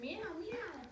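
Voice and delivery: high little mewing meow; high kitten meow. Acted. Cat sound in picture book.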